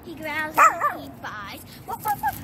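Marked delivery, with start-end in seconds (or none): 1.9-2.4 high yappy woofs like a little dog; dog barking. Pretend. Child singing about her dog, barking high like a puppy.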